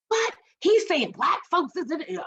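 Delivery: hammed-up outraged question at the author; mock indignant retort. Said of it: "I cussed Kendi out".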